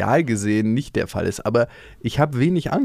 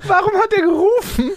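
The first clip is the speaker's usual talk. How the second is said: imitated small child, pitched way up; child's voice; 3 dB louder than usual. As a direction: squeak out the question like a puzzled kid. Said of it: "Und der Lille hat dann später auf dem Fahrrad gefragt".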